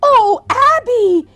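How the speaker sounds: high, loud, thrilled gushing; delighted. Acted. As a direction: burst out with sheer delight. Sister delighted by the discovery.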